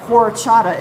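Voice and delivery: horchata said loud with no H sound; silent H. Shown as a demonstration. Mom teaching viewers horchata, loud, no H. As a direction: stresses horchata, leaving out the H. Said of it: "You don't say the H of".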